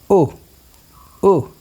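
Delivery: U said as a brief "oo"; short vowel sound. Shown as a demonstration. Teacher comparing short and long sounds, short U. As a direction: keep each U short. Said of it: "begins with U sound".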